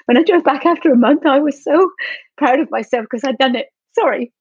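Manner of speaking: really choking up with pride; emotional. Real, not acted. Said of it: "that makes me feel emotional right now".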